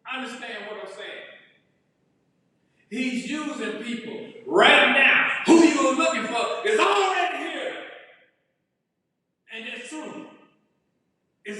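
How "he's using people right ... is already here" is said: preaching shout. Real, not acted.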